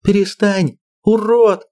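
high furious yell. Acted. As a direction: scream at him to stop. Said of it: "заорала Лера".

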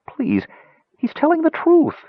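plea rising to a high, strained insistence; plead, climbing high on the claim; begging. A narrator's reading. Kender vouching for his friend, begging. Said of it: "Tass begged".

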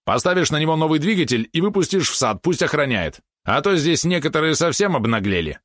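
high, animated pet voice; eager pet helper. Acted. Valeron urges a new guard spider, high and pointed. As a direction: push the plan in a high, lively voice.

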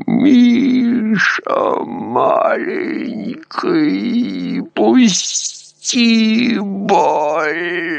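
high, slow, gurgling plea; gurgling alien voice. A narrator's reading. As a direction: plead weakly through blood. Dying man pleads in delirium. (булькающая мольба умирающего)

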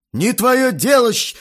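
loud, high retort cut off; angry grunt. A narrator's reading. Guest rebuffs the boy's question, loud and angry. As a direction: snap back and bite off the insult. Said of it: "буркнул Грохотов".

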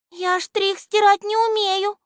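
very high, small, sighing voice; тоненький вздох. A narrator's reading. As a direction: sigh it out in a tiny voice. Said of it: "вздохнул Листик-Ластик". (тоненьким голоском, со вздохом)

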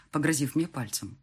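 plain reading voice

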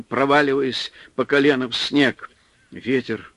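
plain reading voice

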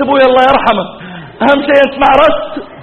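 high, loud, brisk relief; imitated eager wife. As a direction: blurt it out brightly, pitch way up. Wife relieved, brushes off death. (تقليد زوجة مرتاحة بصوت عال)

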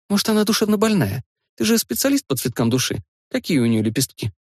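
a hint of playful girlfriend; lightly voiced young woman. Narrator voices Lyudmila teasing.

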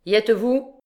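lauter, höher gerufen mit steigender Frage; repeated call. A narrator's reading. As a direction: die Henker nochmals lauter fragen. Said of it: "répéta-t-il".

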